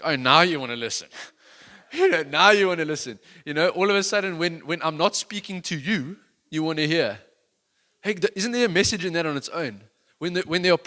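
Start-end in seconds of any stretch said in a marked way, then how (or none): 0.0-1.1 loud mock-sarcastic dad; sarcastic retort. 1.9-7.2 loud, higher chiding; chiding preacher. Acted.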